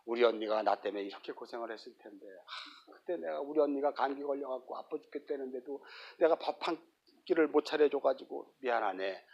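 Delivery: quiet, soft remorseful murmur; lightly voiced weeping sister; mildly tearful regret. Mary regretting her sister's hard work, quietly tearful. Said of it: "많이 울었을 거예요".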